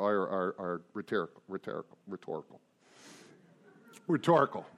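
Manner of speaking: drifting off mock president repeating rhetorical; president impression. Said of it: "Talking like the president now".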